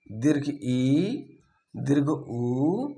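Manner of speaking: ई and उ drawn out long and slow; long vowels. Shown as a demonstration. Teacher showing students how long vowels sound, drawn out. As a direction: stretch each vowel to two beats. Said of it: "दीर्घ, इसमें पूरा आ जाएगा".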